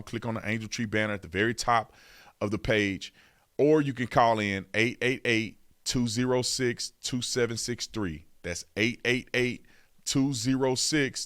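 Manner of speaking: usual speaking voice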